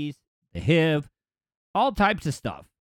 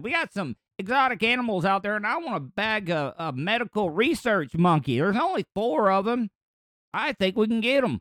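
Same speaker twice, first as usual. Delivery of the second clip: higher-pitched Southern hunter; hammed-up Southern accent, higher voice; 4 semitones higher than usual. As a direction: put on an eager Southern accent. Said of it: "I can only do a southern accent".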